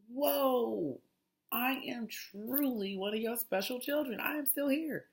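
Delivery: high, hammed-up thankful exclaim; grateful mock exclaim. Praising the universe for survival, high exclaim. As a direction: gush up high like a blessed child. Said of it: "I'm just like".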